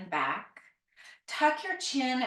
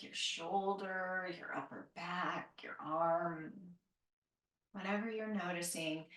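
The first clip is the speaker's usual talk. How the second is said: calm guided meditation; 6 dB quieter than usual. Acted. Guided body awareness, fading soft.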